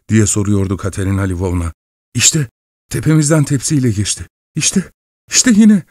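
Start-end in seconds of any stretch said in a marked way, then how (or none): 2.1-5.8 high, rising terrified cries; panicked shouting. A narrator's reading. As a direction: yell in panic at the ghost, much higher. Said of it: "diye bağırdı Sergey".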